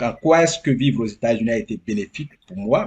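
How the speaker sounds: ordinary talk, nothing marked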